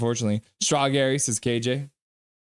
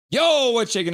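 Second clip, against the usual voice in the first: excited greeting; 9 semitones higher, 4 dB louder than usual. Pretend. Arrival greeting, high and loud.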